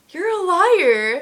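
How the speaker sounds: loud, drawn-out mock accusation; retold accusation. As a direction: stretch it out high, calling him out.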